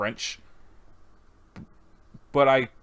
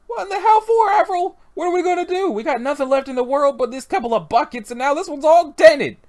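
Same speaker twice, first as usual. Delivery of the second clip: high, loud, wailing character voice; distraught complainer; 7 dB louder than usual. Acted. Complaining to Avril over dented buckets, high distraught wail. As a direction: wail in high-pitched outrage.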